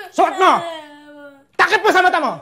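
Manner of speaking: loud barked orders; furious yelled command; angry shout. Acted. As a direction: make the child obey, right now. Father forcing blindfold on child.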